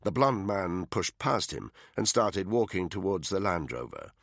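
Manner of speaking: ordinary delivery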